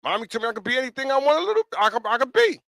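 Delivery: high-pitched retold kid voice; little boy. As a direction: imitate a small child proudly repeating mommy's words. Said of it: "Little boy came to my house and said that".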